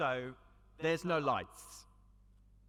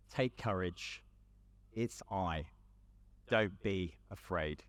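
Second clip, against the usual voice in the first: gentle reassurance; 8 semitones lower than usual. Retold or imitated. Quoting Jesus' words gently.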